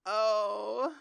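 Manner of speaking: high, slow, laugh-crying; real tearful laugh; near tears. Real, not acted. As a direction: speak through tears that are about to come. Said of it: "I really wanna cry".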